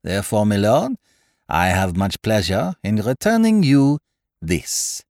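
put-on French-accented detective, ceremonious; Poirot, courtly. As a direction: present it with a French accent and a little flourish. Poirot presents the diamond.

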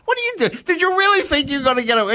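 high, incredulous telling-off in a rush; amused, exasperated challenge; mock scolding. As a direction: retell confronting a friend over a silly lie. Acting out confronting the friend.

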